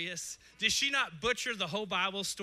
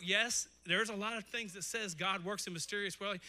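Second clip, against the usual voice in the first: ordinary delivery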